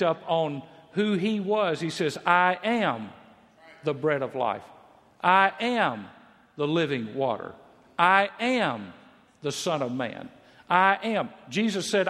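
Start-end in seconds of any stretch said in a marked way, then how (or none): 2.2-11.3 each "I am" raised and loud, then dropping for the title; emphatic. Acted. Preacher quoting Jesus's claims to the crowd, emphatic. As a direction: hit every "I am" hard, pause, then settle. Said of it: "He's saying something very emphatic".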